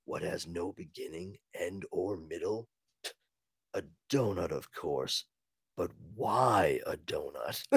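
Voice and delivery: low, hushed, brooding Batman; quiet deep superhero rasp; gruff Batman voice. Acted. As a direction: muse to yourself in a low gravelly growl. Batman voiced musing at computer.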